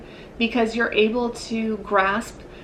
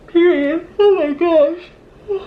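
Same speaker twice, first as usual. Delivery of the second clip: slow, drawn-out, high, loud gasp; surprised; 8 semitones higher, 7 dB louder, slower than usual. Real, not acted. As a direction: stretch it out in amazement. Sudden realization mid-reading.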